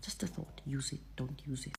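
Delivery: soft offhand murmur; quiet throwaway. Real, not acted. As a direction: drop it casually. Throwaway tag.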